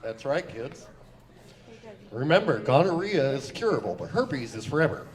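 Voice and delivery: lightly put-on sage father voice; a hint of a pipe-smoking dad lecturing; fatherly advice. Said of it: "giving fatherly advice".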